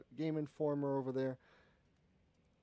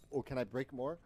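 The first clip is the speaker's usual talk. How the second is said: normal talking voice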